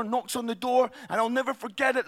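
ordinary talk, nothing marked

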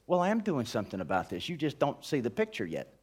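God patiently answering the complaint, soft and low. Acted. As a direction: reassure them quietly.

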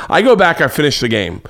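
normal talking voice

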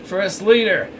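high-pitched, flustered address. A narrator's reading. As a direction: answer a superior, caught off guard. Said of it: "said the flustered man".

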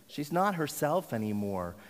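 ordinary talk, nothing marked

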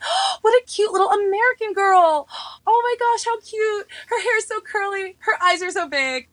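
put-on high cooing over a cute girl; elders cooing. Imitating Korean elders cooing at an American girl, high. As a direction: coo like a doting elder. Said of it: "they're like".

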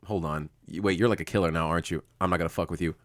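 low, hushed and wary; wary backing-off. Acted. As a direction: back off quietly from a killer. Acting out the Hound backing off.

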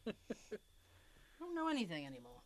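muffled behind a mask; talking through a mask; through a face mask. Real, not acted. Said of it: "A little muffled".

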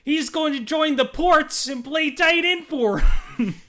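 squeaky Coach Z impression, hammed up. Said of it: "You just sound like Coach Z".

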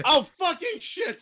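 high, slow startled curse; surprised. Real, not acted. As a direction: caught off guard, blurting it out. Realizing the artist isn't made up.

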